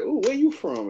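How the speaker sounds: high-pitched imitation of a nosy lady; curious lady. Lady in the supermarket asks where he's from, imitated high and curious. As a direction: imitate her, pitched well up. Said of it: "his lady was like".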